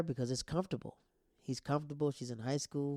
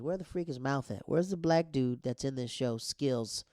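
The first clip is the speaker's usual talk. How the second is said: usual speaking voice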